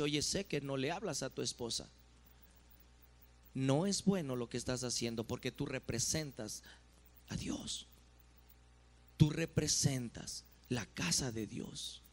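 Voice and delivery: gently admonish, low-key and soft; quiet pastoral reproof. Acted. (amonestación pastoral en voz baja)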